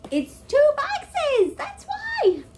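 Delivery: high, loud and slow, insisting; shouting. Real, not acted. Insisting it is two boxes.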